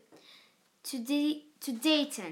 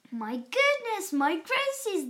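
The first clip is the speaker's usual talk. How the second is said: shouted; 7 semitones higher, 4 dB louder than usual. A narrator's reading. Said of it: "they all shouted".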